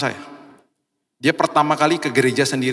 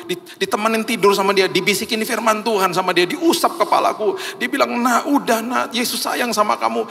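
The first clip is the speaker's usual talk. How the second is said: high-pitched, fervent retelling; urgent, emotional witness, easing down at the end; impassioned testimony; 8 semitones higher than usual. Retold or imitated. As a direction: pour out how you were set free. Retold emotional testimony of freed friend.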